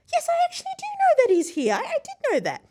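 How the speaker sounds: very high, fast, loud mock British lady; terrible British accent. British lady confirming Richard Branson is here, excited British accent. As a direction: gush quickly in a bad British accent. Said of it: "terrible British accent".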